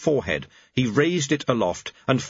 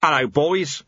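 louder, easy friendly greeting; a touch of pleasant; 5 semitones higher, 4 dB louder than usual. Acted. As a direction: greet them like old friends. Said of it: "said Chaos pleasantly".

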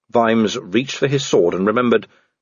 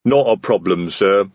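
obliging sergeant; 3 semitones lower than usual. Acted. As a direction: answer the boss as a stolid heavy. Voices Detritus, armoured sergeant.

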